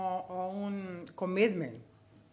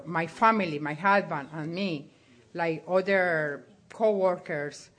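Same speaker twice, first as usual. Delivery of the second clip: usual speaking voice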